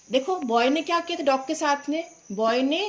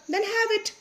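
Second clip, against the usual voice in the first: much higher, coaxing offer; kindly coaxing; 10 semitones higher, much slower than usual. Acted. Mister Roy offering chocolate to the boy, higher coaxing. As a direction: lure him in sweetly. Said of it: "said Mister Roy".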